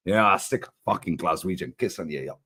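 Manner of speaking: hammy Scottish hard man; Scottish accent. Retold or imitated. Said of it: "My Scottish accent ain't great".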